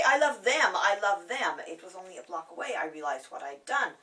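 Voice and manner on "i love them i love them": loud, eager gush; hasty correction. Retold or imitated. Shopper hastily correcting herself to the shopgirl, loud and eager. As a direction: blurt your love for the shoes. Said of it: "I hastened to say".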